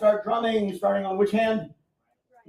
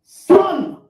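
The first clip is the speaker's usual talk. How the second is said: loud, high Japanese count-in; 13 semitones higher, 8 dB louder, much slower than usual. Shown as a demonstration. Taiko teacher calls the count loudly to the class. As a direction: call the count out big and high. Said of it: "It's gonna sound like this".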